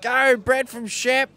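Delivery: high, drawn-out mock chant; imitated fan yell. Interviewer imitating Aussie fans cheering on a rider, mock yell. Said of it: "they've been yelling out things like".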